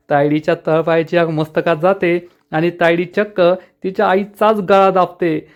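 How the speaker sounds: usual speaking voice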